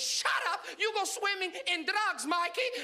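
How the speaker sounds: high, drawn-out mom comeback; high-pitched mock scolding; scolding immigrant mother. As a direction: cut him off as an accented mother. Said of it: "And she goes".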